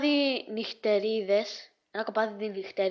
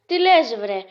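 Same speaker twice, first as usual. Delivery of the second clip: acted indignant retort; 9 dB louder than usual. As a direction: fire back in mock indignation. (δήθεν αγανακτισμένη απάντηση)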